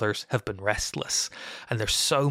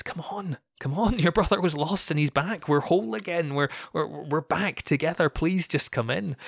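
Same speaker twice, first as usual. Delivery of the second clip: higher-pitched begging, mildly acted; pleading; 6 semitones higher than usual. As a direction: plead with him to come round, urgent and coaxing. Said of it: "begging him, he's pleading with him".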